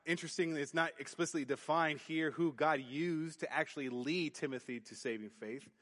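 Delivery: normal talking voice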